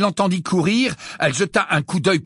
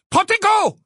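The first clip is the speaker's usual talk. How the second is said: shouted spell; 4 dB louder than usual. A narrator's reading. Bellatrix casts shield.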